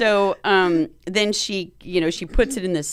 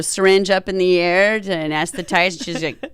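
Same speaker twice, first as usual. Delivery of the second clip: ordinary talk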